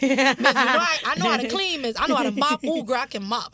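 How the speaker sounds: put-on loud, eager kid bragging to his teacher. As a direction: sell it like a boy begging to be picked. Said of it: "let me tell you how they get me".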